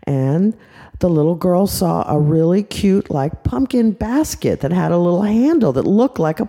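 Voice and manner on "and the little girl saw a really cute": ordinary delivery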